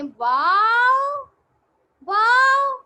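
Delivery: very slow, loud, high wow; excited wow. Pretend. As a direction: pretend amazement for the kids. Teacher pretending to see a duck.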